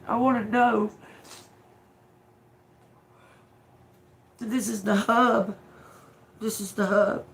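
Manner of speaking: outraged. Real, not acted. Outraged about unsolved murders.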